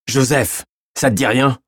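insistent questioning. A narrator's reading. Gendarme prodding a sobbing suspect about a name, insistent. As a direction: press him for an answer.

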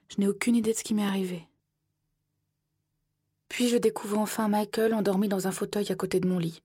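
ordinary delivery

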